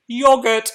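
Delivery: high, loud "Yogurt" with short O, YOG-urt; punched-up British YOG-urt for "Yogurt". Shown as a demonstration. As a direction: exclaim "Yogurt" in exaggerated British style. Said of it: "It sounds so British".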